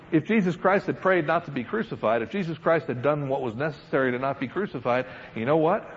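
ordinary talk

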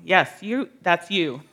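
ordinary talk

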